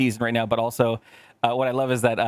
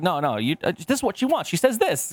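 parent translating; 6 semitones higher than usual. Retold or imitated. Explaining kid speech to others.

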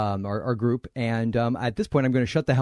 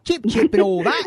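higher-pitched, hammed-up Cockney; badly faked Cockney chirp; 7 semitones higher, 4 dB louder than usual. Host goading a reluctant guest, hammed-up Cockney. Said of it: "I'm not doing Cockney".

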